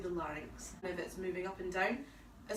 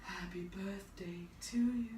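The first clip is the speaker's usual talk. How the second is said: breathy aspirate tone; 3 semitones lower, 4 dB quieter, slower than usual. Shown as a demonstration. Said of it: "is very kind of aspirate sounding, breathy".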